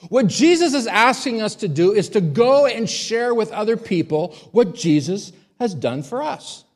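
high, loud, slowed preaching voice; forceful preaching. Acted. Preacher urging the congregation to share, forceful.

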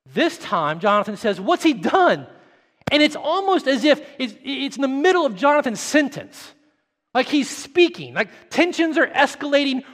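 high, loud impassioned preaching; preaching at full force. Acted. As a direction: drive it home to the congregation.

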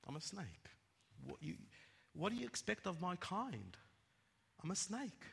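soft, understated snake reply; unrepentant snake. Acted. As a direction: answer the shouting boy quietly. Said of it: "He goes".

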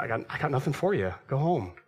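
fast, brisk dismissal, like a host sending you off; quick game show host. Pretend. Preacher voicing a Survivor host ending the round, fast.

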